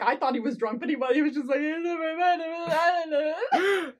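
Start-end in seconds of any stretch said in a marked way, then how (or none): 1.6-3.9 slow, high, weepy mumble; mock tearful. Retelling the drunk character's whine, slow and weepy. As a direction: whimper it like a sad drunk.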